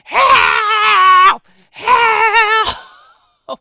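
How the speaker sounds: high shrill cry of help, hammed up; mock screaming for help. Mimicking the driver screaming help from her window. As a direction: scream help like a panicked woman. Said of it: "starts screaming at the top of her lungs".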